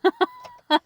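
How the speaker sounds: short high laugh; bright sudden laugh; burst of laughter. Real, not acted. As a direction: laugh out loud at the dead car. Laughing at killing the car.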